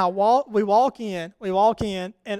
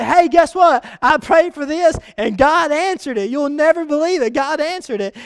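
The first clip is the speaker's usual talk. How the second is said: higher, louder, bursting with good news; excited announcing; 6 semitones higher, 6 dB louder than usual. Acted. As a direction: gush it out to the neighbour, high and loud.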